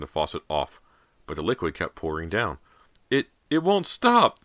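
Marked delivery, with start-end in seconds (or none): 3.1-4.4 high, loud, stammered scream. A narrator's reading. Girl at an overflowing sink, screaming in panic. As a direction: scream it out, stretched on a high pitch. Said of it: "Cody screamed".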